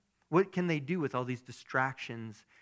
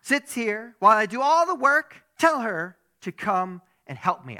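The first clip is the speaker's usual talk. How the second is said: higher, louder upset Martha voice, slowed at the start; 8 semitones higher, 10 dB louder than usual. Acted. Preacher voicing Martha complaining to Jesus, higher and louder. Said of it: "I don't know why her voice sounds like that in my head".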